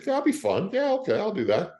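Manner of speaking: high-pitched, cheerful retold yes; happily going along with it; eager agreement. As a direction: go up high, lightly game for it. Retold eager reply to agent.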